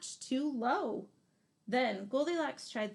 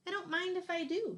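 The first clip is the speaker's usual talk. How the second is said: lightly voiced cheeky girl, higher and quick; a quick, higher, cheeky little girl; Goldilocks; 8 semitones higher, faster than usual. Goldilocks helps herself to the biggest bowl, lightly voiced cheeky girl. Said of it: "she said".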